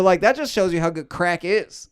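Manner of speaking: ordinary talk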